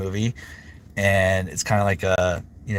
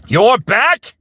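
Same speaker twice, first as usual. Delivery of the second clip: high character voice; 12 semitones higher, 8 dB louder than usual. Acted. Greets chat in high put-on voice.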